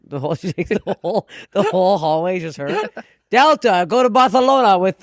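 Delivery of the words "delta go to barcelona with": louder, higher mock pilot call; lightly put-on boarding call, projected; mock airline announcer.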